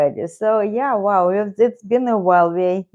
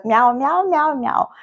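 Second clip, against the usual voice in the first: high, quick meowing, softening at the end; string of mock meows, pitched up; imitated cat meows; 6 semitones higher, 4 dB quieter than usual. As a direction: mimic your cat meowing back at you. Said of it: "No, he says".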